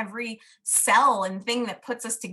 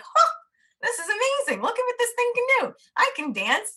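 much higher, bursting with wonder; delighted amazement; 4 dB louder than usual. Retold or imitated. Realising she can dance, she squeals with much higher amazed delight. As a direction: gasp it like a child discovering magic.

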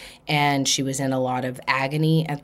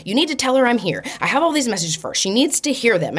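a pushy mother's voice pitched higher; insistent spirit mother; 7 semitones higher, faster than usual. Retold or imitated. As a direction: press her to pass the message on.

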